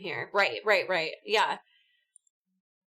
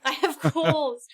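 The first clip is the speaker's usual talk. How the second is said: laughing; 7 semitones higher, 4 dB louder, slower than usual. Retold or imitated. Echoing with laughter.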